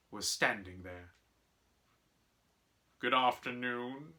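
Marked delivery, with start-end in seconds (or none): 3.0-4.1 smooth. Acted. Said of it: "he said smoothly".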